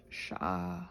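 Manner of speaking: ordinary delivery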